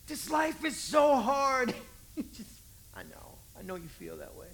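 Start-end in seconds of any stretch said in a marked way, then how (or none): 0.1-1.7 slow, loud mock wail. Believer whines to Jesus, loud mock wail. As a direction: drag out a melodramatic cry.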